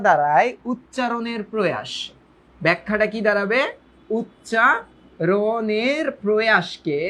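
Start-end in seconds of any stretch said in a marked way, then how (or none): 4.2-7.0 very slow, syllables drawn out; slow dictation. Shown as a demonstration. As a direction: dictate it piece by piece, stretching each syllable. Dictating slowly to the class. (ধীরে ধীরে টেনে বলা)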